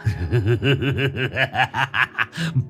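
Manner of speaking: cackling madman's laugh; unhinged gleeful cackle; maniacal laugh. A narrator's reading. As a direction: burst into crazed laughter. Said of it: "tiếng cười sằng sặc".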